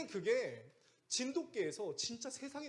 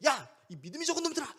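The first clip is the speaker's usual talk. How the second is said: high, loud, fast angry telling-off; scolding; 10 semitones higher, 5 dB louder, faster than usual. Acted. Preacher showing how Jesus did not rebuke disciples, angry scolding. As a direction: bawl them out in acted anger. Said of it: "이렇게 꾸짖는 게 아니라".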